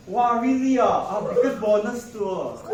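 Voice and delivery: loud laughing reply. Retold or imitated. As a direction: chuckle while answering. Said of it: "the officer".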